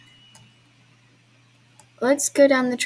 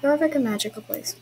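higher, quicker playful slogan; brisk slogan; 5 semitones higher than usual. Pretend. Player repeating the Jorvik slogan, brisk and higher. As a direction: say it in one lifted sweep.

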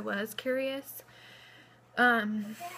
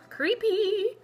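mock spooky; 10 semitones higher, 6 dB louder than usual. Real, not acted. Spooky moment in her video.

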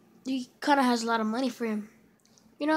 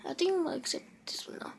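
fast, soft whispered aside; under-the-breath aside while clicking through trades; 5 semitones higher, 7 dB quieter, faster than usual. Real, not acted. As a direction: whisper it to yourself quickly.

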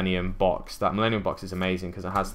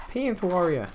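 high-pitched attempt at a Brooklyn accent; badly faked Brooklyn accent; 9 semitones higher than usual. Card opener naming a pulled card, botched Brooklyn accent. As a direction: try a Brooklyn accent and miss it. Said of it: "I can't do a Brooklyn accent".

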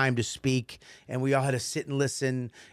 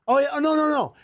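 high, loud mock backpedaling; imitating an over-agreeable coworker; flustered backpedaling; 12 semitones higher, 5 dB louder than usual. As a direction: hastily give way, voice pitched up. Acts out coworkers hastily backing down.

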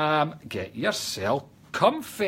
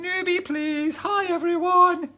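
very high, hammed-up newcomer voice; mock squeal of a newcomer; Nicole's newbie greeting; 4 dB louder, slower than usual. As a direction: pipe up high, stretching the hello. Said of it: "And Nicole's saying".

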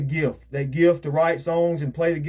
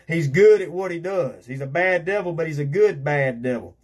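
ordinary talk, nothing marked

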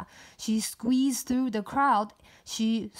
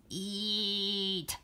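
creepy volcano voice; 5 dB quieter than usual. Acted. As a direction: murmur it like a lurking monster. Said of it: "好可怕".

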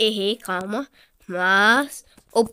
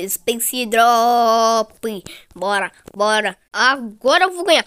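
usual speaking voice

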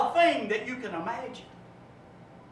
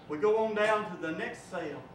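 normal talking voice

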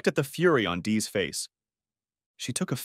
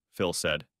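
ordinary delivery, nothing marked

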